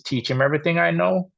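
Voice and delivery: ordinary talk, nothing marked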